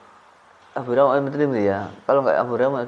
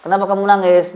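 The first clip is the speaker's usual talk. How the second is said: higher-pitched mock questioner; concerned questioner; 6 semitones higher than usual. Playing someone asking a weeping person, mock concern.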